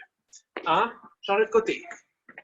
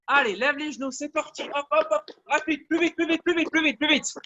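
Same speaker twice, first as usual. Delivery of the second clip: higher-pitched, rapid-fire urging; urgent coaching; 3 semitones higher than usual. Real, not acted. Coach pushing the class to lift knees faster, urgent. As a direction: drive them on with quick repeated cues.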